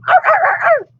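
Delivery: very high happy yaps; husky barks. Acted. Huskies greeting Aluki, high happy barks. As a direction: bark like bouncing huskies.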